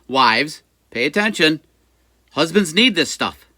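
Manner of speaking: loud, high call to the audience; mock-stern call. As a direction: call out playfully, driving the lesson home. Calling out to wives watching.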